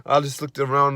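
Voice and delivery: normal talking voice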